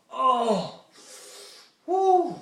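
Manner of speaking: high, loud excited whoop; excited hype. Retold or imitated. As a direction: shout it like the best food ever. Hyping auntie's macaroni.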